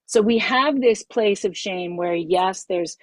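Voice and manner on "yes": ordinary talk, nothing marked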